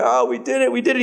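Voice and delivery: high, relieved cry that we did it; overjoyed relief at the end of the shoot; relieved excitement. Retold or imitated. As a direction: lift the voice in giddy relief. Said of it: "emotional release of".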